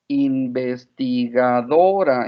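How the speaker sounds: "Investigadora" stretched out at half speed; drawn-out word. Retold or imitated. Mother voices daughter facing a huge word, drawn-out. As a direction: say "Investigadora" very slowly, every syllable held.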